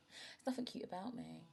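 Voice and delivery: ordinary talk, nothing marked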